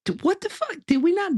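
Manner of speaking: a sudden, high-pitched what the fuck; surprised outburst. Real, not acted. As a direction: pitch jumps way up in disbelief.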